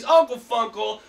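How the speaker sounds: ordinary talk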